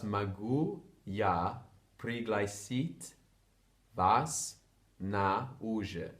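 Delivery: slow, joined-up, unhurried; whole phrase slowly. Shown as a demonstration. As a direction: say the full phrase slowly as a single line. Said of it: "So that's".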